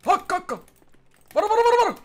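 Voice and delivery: real alarm in the heat of a firefight; urgent shout. Real, not acted. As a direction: yell to warn your team mid-fight. Firefight, warning teammates.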